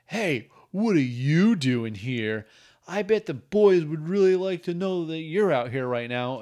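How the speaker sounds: hammed-up threat to tell; mock taunting. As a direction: lord it over them in a character voice. Acts character catching someone out.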